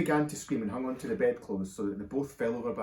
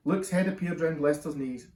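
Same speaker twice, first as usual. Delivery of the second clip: ordinary delivery, nothing marked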